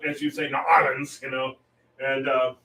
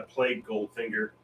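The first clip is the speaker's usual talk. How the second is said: ordinary delivery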